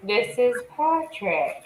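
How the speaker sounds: slow, dopey Patrick impression with a laugh. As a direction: drag it out like a slow cartoon starfish. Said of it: "We'd just be like".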